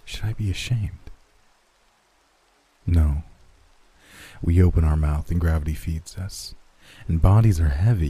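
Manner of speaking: usual speaking voice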